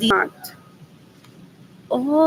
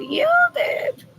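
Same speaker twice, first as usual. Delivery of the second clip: high whisper. Shown as a demonstration. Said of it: "Who had the better upbringing".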